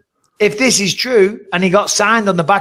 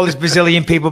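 ordinary talk, nothing marked

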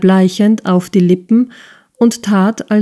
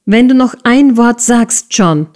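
higher and louder, shouting him down; cutting in with a shout; 6 semitones higher, 4 dB louder than usual. A narrator's reading. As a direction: stop her fiancé mid-sentence, half shouting. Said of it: "schrie Miss Price dazwischen". (dazwischenrufend, höher und lauter)

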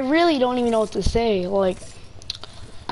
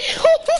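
high excited laugh; 4 dB louder than usual. Real, not acted.

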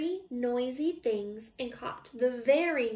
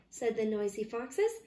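ordinary delivery

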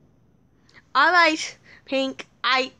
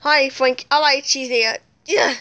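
ordinary talk

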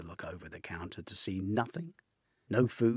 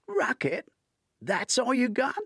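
incredulous; 9 dB louder than usual. A narrator's reading. Incredulous reply.

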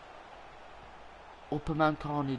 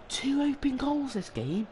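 exasperated wail; 13 semitones higher, slower than usual. Real, not acted. Frustrated after missing open goals.

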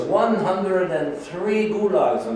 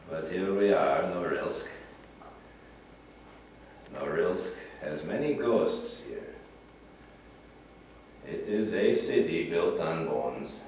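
sombre; 8 semitones lower, 8 dB quieter than usual. Acted. Sombre turn to the city's dead.

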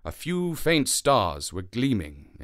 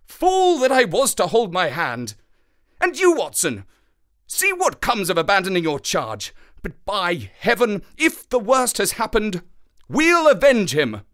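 high, loud self-reproach turning to vengeance; impassioned, raised-voice outburst; anguished fury; 9 semitones higher, 5 dB louder than usual. Acted. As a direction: rail bitterly, then swear revenge. Holmes rages at himself and Watson.

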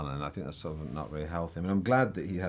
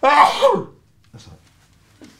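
a big sudden sneeze mid-phrase; sneezing; 21 dB louder, much slower than usual. Real, not acted. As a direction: sneeze bursting through words. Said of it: "sorry, I'm gonna sneeze now".

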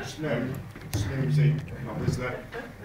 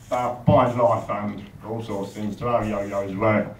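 usual speaking voice

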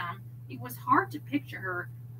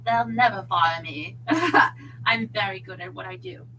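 put-on velvety British accent with a chuckle; self-assured British charmer; Graham; 3 semitones lower, 5 dB louder than usual. A narrator's reading. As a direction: boast smoothly in a British accent. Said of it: "Graham's velvety British accent was unmistakable".

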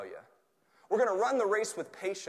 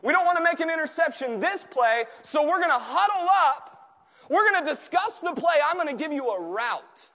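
high, loud rallying sermon; full-force preaching; 13 semitones higher, 12 dB louder than usual. Real, not acted. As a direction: fire them up like a team before a play. Preaches football analogy at full force.

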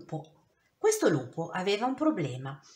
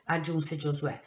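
ordinary delivery, nothing marked

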